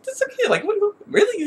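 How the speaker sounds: very high, squeaky mock disbelief; incredulous reaction. Fan reacting to Bolin's naivety, high mock disbelief. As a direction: stammer it high in amused disbelief. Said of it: "I was like".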